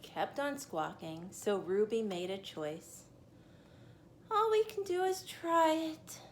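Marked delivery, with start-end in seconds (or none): 4.3-6.2 higher, louder than told; tiny voice. Acted. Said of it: "she said in a tiny voice".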